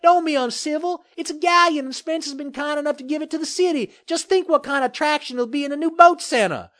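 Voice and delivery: high-pitched, placating character voice; quick, high and cajoling, with a smile; smiling mayor. A narrator's reading. Mayor selling the galleon to the developer, smiling cajolery. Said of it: "said with a smile".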